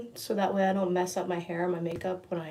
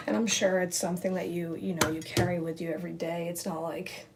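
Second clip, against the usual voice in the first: ordinary talk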